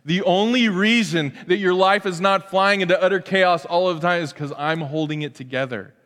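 normal talking voice

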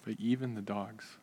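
meek soft-spoken supplicant; humble pleading woman. Acted. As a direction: plead gently, head bowed. Preacher voicing the humble woman.

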